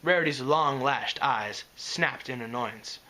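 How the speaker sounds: ordinary delivery, nothing marked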